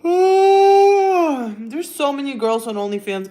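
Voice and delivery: slow, loud sighing whine; a drawn-out mock cry; mock lament. Real, not acted. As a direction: groan it out like it's tragic.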